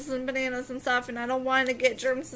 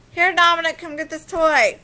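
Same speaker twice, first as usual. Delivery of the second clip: calling out; 4 semitones higher, 9 dB louder than usual. Real, not acted. Calling to her son.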